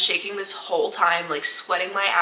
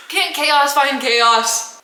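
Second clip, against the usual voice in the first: exasperated; 5 semitones higher, much slower than usual. Real, not acted. Exasperated rhetorical question.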